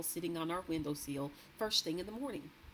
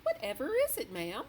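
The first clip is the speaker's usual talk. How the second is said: higher, timid maid voice; a nervous young maid answering her mistress; maid's voice; 8 semitones higher, 5 dB louder than usual. A narrator's reading. Maid anxiously asking her mistress what is wrong.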